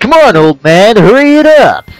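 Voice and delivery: put-on high, loud impatient young hero; impatient shout. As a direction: bark at the old tree. Voicing Link impatient.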